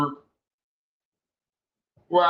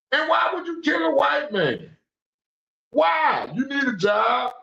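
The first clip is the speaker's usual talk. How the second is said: angry shout; 9 semitones higher than usual. Real, not acted. Ranting on stream about the gun talk, angry shout. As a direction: shout the question down at them.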